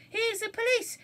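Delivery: frightened burglar hearing boots; panicked. Acted. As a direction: gasp it in alarm.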